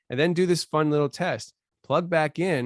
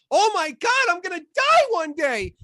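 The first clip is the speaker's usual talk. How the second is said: much higher, louder mocking panic; 14 semitones higher, 5 dB louder than usual. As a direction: ham up a scared person realising they'll die. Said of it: "got the whole world riled up".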